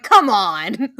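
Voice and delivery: loud mock exasperation, half laughing; Bob. Host retelling Bob's reaction to Teddy, loud laughing protest. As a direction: imitate a protest, belted out. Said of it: "Bob being like".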